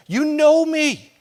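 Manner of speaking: high, loud and slow protest; man yelling at God, hurt and angry. Retold or imitated. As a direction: shout your case up to God. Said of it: "This is what I would tell him".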